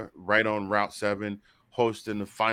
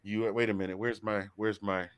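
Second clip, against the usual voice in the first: ordinary delivery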